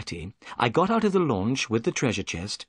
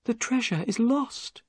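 quiet; 12 semitones higher than usual. A narrator's reading. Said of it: "said Miss Morstan quietly".

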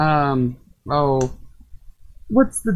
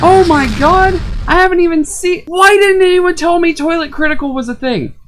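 high, loud disbelieving yells; excited shouting; 10 semitones higher, 6 dB louder than usual. Real, not acted. Gamer yelling at a surprise boss, excited. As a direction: shout in shocked delight, much higher and louder. Sees new boss appear.

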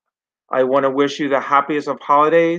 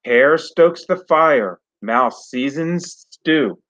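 put-on lively storybook read-aloud for kids; reading to children. A narrator's reading. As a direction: tell it like bedtime story to a class. Teacher reads picture book aloud.

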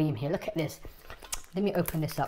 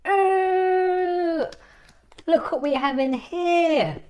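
excited squeal; 13 semitones higher, 6 dB louder, slower than usual. Real, not acted. Squeals, voice changed.

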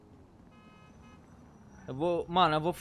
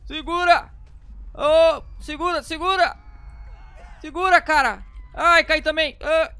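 high, urgent pleading to hold on; cling on in a panic; anxious high shouting; frantic; 8 semitones higher, 4 dB louder than usual. Real, not acted. Frantic holding on in quake.

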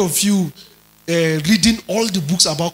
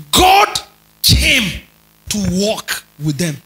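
high, ringing preacher's proclamation; preaching; 5 semitones higher, slower than usual. Acted. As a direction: proclaim it to the congregation, stretching key words. Preaches at full force.

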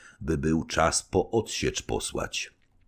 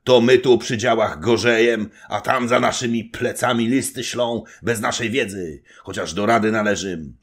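higher, louder indignant outburst; grumbling officer; 8 semitones higher, 9 dB louder than usual. A narrator's reading. Officers fume at the gates, loud indignant complaint. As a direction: bristle with anger. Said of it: "powtarzał ten i ów".

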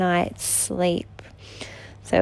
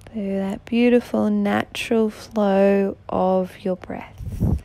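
guided relaxation. Acted. Guiding a listener in bed through breathing before sleep, soothing meditation voice. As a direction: gently lull the listener towards sleep, unhurried.

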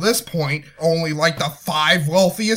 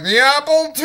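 grand unveiling; 9 semitones higher, 5 dB louder than usual. Retold or imitated. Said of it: "Steve Jobs is like".

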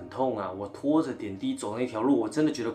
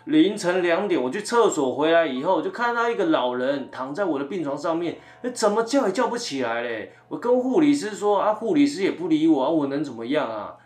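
loud, higher-pitched complaint; complaining; 6 semitones higher, 8 dB louder than usual. Retold or imitated. Patient complains loudly to the doctor and nurse. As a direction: vent your grievance loudly. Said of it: "这时候我就开始抱怨了".

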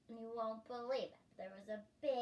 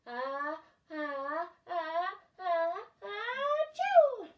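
gasps climbing louder and higher into a big achoo; building pretend sneeze; 7 semitones higher, 12 dB louder, much slower than usual. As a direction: build up to a huge storybook sneeze. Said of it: "if he tickled them, they would go".

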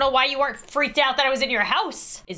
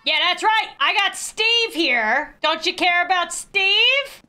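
drawn-out mock threat; hostage-taker taunt; 4 semitones higher, slower than usual. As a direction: call out slowly to the police, playing it up.

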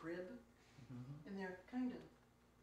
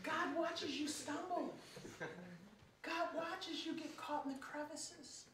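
slow preaching; 4 semitones higher, slower than usual. Acted. Sermon point, slowed.

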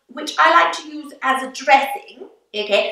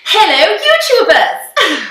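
excited greeting; 6 semitones higher, 6 dB louder, slower than usual. Acted. Vlogger's opening greeting.

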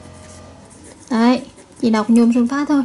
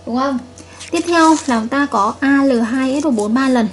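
ordinary delivery

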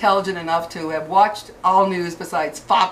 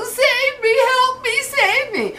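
much higher, whiny mock cry for help; mock pleading patient; 3 dB louder, much slower than usual. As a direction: ham up a desperate patient begging to be saved. Mocking anti-maskers begging in hospital.

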